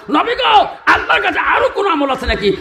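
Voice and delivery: high-pitched acted appeal; calling out. Companion asking the Prophet about another deed, high calling cry. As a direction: cry out the question, starting high.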